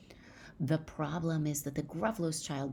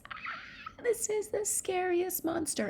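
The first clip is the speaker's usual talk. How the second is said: very high squealed fright; mock terror; slower than usual. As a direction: play-act a terrified child shrieking at a monster. Child terrified by huge shadow.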